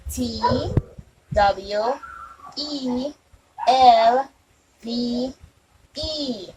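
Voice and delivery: letters spelled one by one with pauses; spelling out letters. Shown as a demonstration. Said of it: "We're going to do it one more time".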